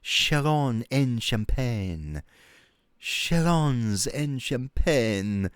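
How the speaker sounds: slow, drawn-out mock French place name; drawn-out French Chalons en Champagne. Shown as a demonstration. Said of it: "adding extra words on the end".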